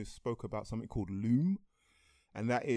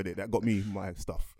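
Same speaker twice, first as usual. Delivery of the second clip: ordinary talk, nothing marked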